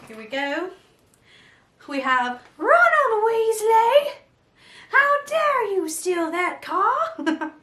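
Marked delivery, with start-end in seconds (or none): 2.6-7.5 loud, high, drawn-out British telling-off; mock scolding mother. Mother scolding Ron over stolen car, hammed-up fury. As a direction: scold theatrically, stretching the name out. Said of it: "I love whenever she's talking".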